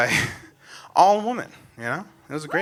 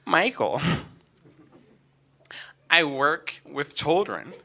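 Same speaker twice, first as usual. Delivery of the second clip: lower, softer patient mother; a hint of a patient mother; calm mom; 5 semitones lower, 6 dB quieter than usual. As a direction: explain it gently like mom. Mom answering calmly.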